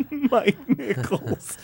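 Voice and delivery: giggling amazement at a famous name; laughing disbelief. Real, not acted. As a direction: marvel at the punchline while laughing.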